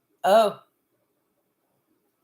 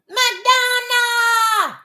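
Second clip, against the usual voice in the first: long, high hollered call of "Madonna"; real excited holler at a friend; hollered name; 13 semitones higher, 8 dB louder, much slower than usual. Real, not acted. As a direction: holler the name across the room, stretched slow. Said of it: "Sorry, I hollered".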